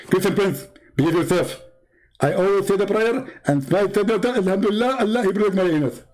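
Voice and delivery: a smug preacher figure explaining his proof; mock Zakir Naik. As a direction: lecture the caller with mock confidence. Preacher figure scolding the caller.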